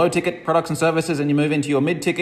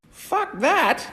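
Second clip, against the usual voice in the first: mock scoffing dismissal; 12 semitones higher than usual. Mocks conventional person's reply.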